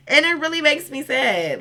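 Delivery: high, pleading mock upset; playfully hammed-up sorrow; mock sad whine. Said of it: "It's so sad".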